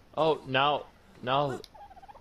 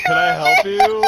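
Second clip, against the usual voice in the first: very high, loud fake politeness; mock polite squeak; 11 dB louder than usual. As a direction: greet the shark in a squeaky voice. Mock greeting to shark.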